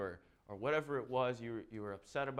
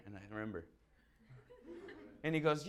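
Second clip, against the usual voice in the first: ordinary talk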